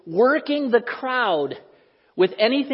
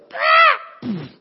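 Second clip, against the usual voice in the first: loud high peacock squawk; 6 dB louder than usual. Retold or imitated. Preacher imitates the peacock at the fence with a squawk.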